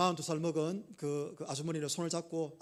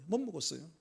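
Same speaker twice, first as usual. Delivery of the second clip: quieter, hushed echo of her words; softly repeated quote; 4 dB quieter than usual. Retold or imitated. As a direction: repeat it gently, as if it hurts to say. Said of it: "이러네".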